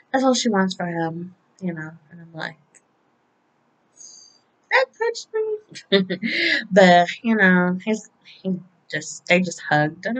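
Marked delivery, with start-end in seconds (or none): 4.7-6.2 very high, drawn-out reaction; mock-touched squeak. Fan tells viewers of her reaction, mock-touched. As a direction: squeeze it out high and slow, as if moved.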